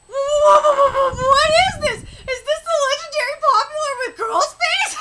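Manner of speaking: high, shouted burst of hype; excited disbelief. Real, not acted. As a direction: yell it out in giddy disbelief. Excited reaction to manga reading.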